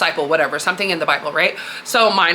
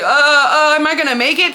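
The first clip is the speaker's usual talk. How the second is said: high, louder mock panic; imitated freak-out; 6 semitones higher, 4 dB louder than usual. Simon looking round at the storm, imitated panic. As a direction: stammer the "uh, uh", then a high worried question. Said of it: "He starts freaking out".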